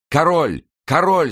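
two high, loud cries; shouting. A narrator's reading. Deceived English soldiers spot the king, high loud shouts. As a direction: cry the alarm, pitch shooting up. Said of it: "закричали несколько англичан".

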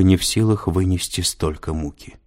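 plain reading voice

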